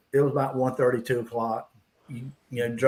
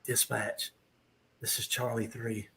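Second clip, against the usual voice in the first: hushed radio report, voice dropped low; whispered radio call; 3 semitones lower, 12 dB quieter than usual. Retold or imitated. Officer whispering to dispatch from the unmarked car. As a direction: whisper into the radio so nobody hears. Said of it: "he's whispering on the radio".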